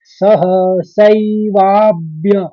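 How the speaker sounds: सह सैवाभ्य drawn out, ब stressed in वाभ्य; slow syllables. Shown as a demonstration. Teacher demonstrating सह सैवाभ्य stress slowly. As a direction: stretch सह सैवाभ्य, hit the ब. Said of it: "तो हम यहाँ पर पढ़ेंगे".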